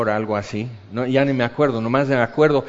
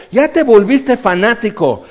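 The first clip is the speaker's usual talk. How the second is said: teasing friend; 7 semitones higher, 6 dB louder than usual. Retold or imitated. Friend mocks him.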